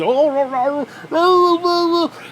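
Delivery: high, loud hammed-up moans; mock moaning. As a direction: ham up a spooky groan. Imitating wrestler's teeth-flailing groans.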